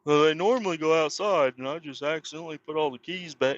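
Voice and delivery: mock-innocent high coworker voice; mocking excuse. Mocking the coworker who took the keys, high mock-innocent voice.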